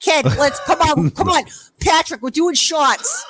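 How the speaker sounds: John. Retold or imitated. Drunk brother urges everyone to do shots, rowdy. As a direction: imitate a drunk pal egging everyone on. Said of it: "He's fucking drunk as hell".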